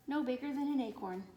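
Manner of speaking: ordinary delivery, nothing marked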